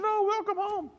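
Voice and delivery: high falsetto wife voice. Retold or imitated. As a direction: go way up high to sound like a woman. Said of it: "She doesn't really talk in that high of a voice".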